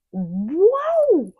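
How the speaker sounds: long loud drawn-out exclamation; big stretched-out cry of real surprise; amazed whoa. Real, not acted. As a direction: let the amazement swell loud and slow. Visitor count jumps on screen.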